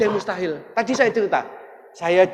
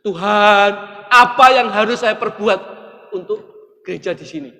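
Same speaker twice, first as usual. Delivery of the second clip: anguished cry to God; 3 semitones higher, 9 dB louder than usual. Retold or imitated. Preacher retelling his plea to God, loud and pleading.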